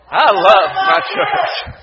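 slow, loud and full of conviction; big, slow congregational shout; loud unison declaration. Real, not acted. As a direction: say it like you mean it, all together. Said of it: "if you will, with some volume".

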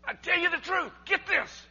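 emphatic insistence. Acted. Preacher driving the verse home to listeners, loud emphatic insistence. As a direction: drive it home loudly, demanding attention. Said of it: "I'm gonna emphasize this".

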